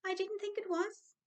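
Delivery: high, resigned little sigh in the words; sighing leprechaun. Acted. Leprechaun sighing at Dan's greed in a high voice. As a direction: let the words sag with weariness. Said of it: "sighed the leprechaun".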